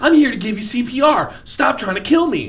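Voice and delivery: high, pleading mock voice; lightly voiced pleading old man. Acting out the old man's plea, in a high, lightly hammed-up voice. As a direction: play the confused victim.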